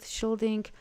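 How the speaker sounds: normal talking voice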